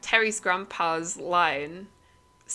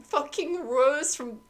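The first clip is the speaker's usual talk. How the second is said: high, frantic and confused, louder; boy adrift panics after grandpa vanishes; panicked screaming; 9 semitones higher, 4 dB louder than usual. A narrator's reading. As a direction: spiral into panic on the wreckage. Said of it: "Terry's screaming and crying".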